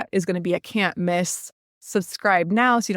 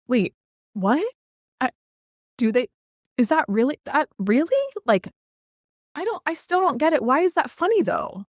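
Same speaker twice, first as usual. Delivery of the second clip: halting feigned bewilderment, rising higher as it goes; playing dumb; 6 semitones higher than usual. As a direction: stammer in mock confusion, pitch climbing. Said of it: "You're gonna play dumb".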